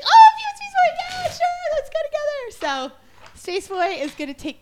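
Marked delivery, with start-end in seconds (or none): 0.0-2.9 high, loud thrilled squeal; over-the-top delighted mom; excited mom impression. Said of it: "She's like".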